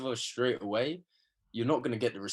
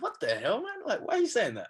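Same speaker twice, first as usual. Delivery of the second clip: higher-pitched incredulous protest; retold disbelief; 10 semitones higher than usual. Player recalling his reply to friends' jibe, incredulous.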